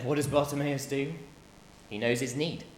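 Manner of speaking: normal talking voice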